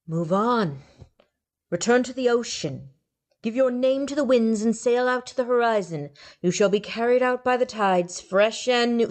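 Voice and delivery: lofty benediction; solemn blessing. A narrator's reading. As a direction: send the soul off kindly. God gives a blessing.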